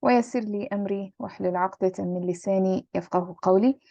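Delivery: quick murmured prayer recitation; rapid devotional recital; opening dua. Acted. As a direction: rattle off the opening supplication.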